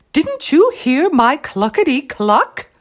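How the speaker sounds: high, loud mother hen's question; scolding mama hen. A narrator's reading. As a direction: cluck at the wayward chicks. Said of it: "Ma said".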